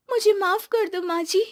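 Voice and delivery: high-pitched, contrite plea; remorseful young wife pleading; pleading apology. Acted. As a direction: beg forgiveness in a high little voice. Daughter-in-law begs forgiveness.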